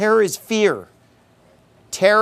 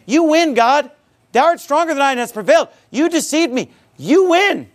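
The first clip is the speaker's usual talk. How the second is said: upset cry to God, much higher; Jeremiah; 9 semitones higher than usual. Acted. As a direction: throw up defeat in high, exasperated protest. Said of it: "he's crying out to God in his, in his anguish".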